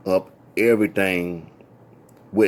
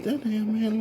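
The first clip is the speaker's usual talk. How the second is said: high shocked exclaim; 12 semitones higher, 6 dB quieter than usual. Retold or imitated. Shocked remark about Zebo.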